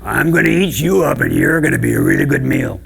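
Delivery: loud, rough troll threat; growling troll. Acted. Troll threatening to eat large billy goat, loud growl. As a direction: roar with hammed-up monster hunger.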